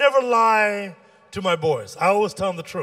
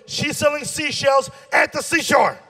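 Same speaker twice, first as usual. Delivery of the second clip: high, exasperated rant; hammed-up indignation over something trivial; mock outrage; 7 semitones higher than usual. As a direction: build to an indignant punch at the end. Mock outrage at Sally's business.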